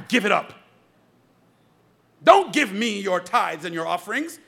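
normal talking voice

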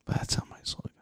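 breathy whispered aside; hushed whisper. Real, not acted. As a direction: drop to a secret whisper. Quiet aside to co-host.

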